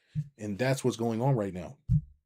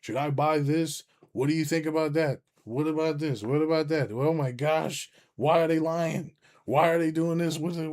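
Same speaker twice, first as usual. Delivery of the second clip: anxious newcomer; 6 semitones higher, 4 dB louder than usual. Retold or imitated. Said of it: "You can be like".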